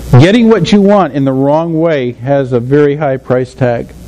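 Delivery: ordinary talk, nothing marked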